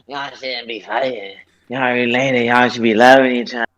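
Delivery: low, slow, drawn-out grandma scolding. Retold or imitated. Grandson mimics grandma lecturing the fighting kids, grandma voice. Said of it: "then my grandma like".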